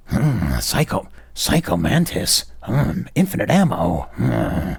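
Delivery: lightly imitated gruff hero, mostly his own voice; a half-hearted take on a tough soldier growl; Snake voice. Mocking the dub's Snake, a light imitation of his put-on voice. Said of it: "somebody doing a voice".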